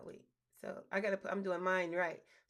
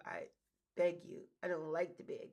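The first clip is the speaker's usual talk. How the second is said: ordinary talk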